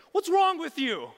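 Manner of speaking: high, very loud scolding shout; angry yelling. Acted. Mock angry Jesus yells at Peter from shore, furious. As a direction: yell it furiously across the water. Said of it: "yelling at Peter like".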